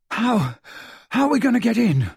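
high, breathless hurried question; winded, broken-up urgent question; panting. A narrator's reading. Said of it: "panted Ron".

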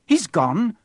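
Harry frantic at the news. Acted. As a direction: blurt it out in alarm. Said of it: "said Harry frantically".

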